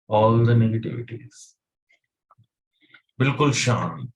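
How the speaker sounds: slow, measured meditation guide with long pauses; guided meditation. Real, not acted. Teacher guiding listeners to control their breath, calm meditation voice. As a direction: lead them calmly through each breath, drawing out the cues.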